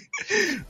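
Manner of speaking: squeaky burst of laughter; high laugh. Real, not acted. Laugh after joke.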